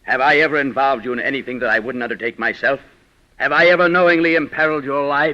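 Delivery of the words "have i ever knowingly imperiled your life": indignant. Acted. Lion protests indignantly.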